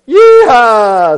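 very high, loud whoop; long storybook holler; wild screech. A narrator's reading. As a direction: screech it like a gleeful ape. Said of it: "screeched Ape".

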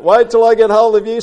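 high, loud crocodile threat; angry snap. A narrator's reading. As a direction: shout a threat after the thief. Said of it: "snapped Crocodile".

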